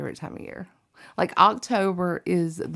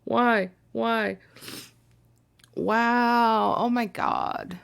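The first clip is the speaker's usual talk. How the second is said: teary-eyed; 3 semitones higher, much slower than usual. Real, not acted. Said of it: "I'm already getting teary-eyed".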